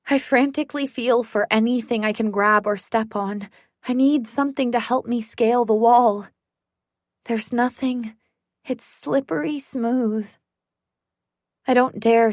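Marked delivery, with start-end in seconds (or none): none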